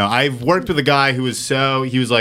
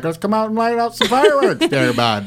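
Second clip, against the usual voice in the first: high, dopey mock Vermont local; dumb Vermont accent; 8 semitones higher than usual. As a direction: imitate a dumb-sounding Southern-Canadian Vermont accent. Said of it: "they sound fucking dumb as hell".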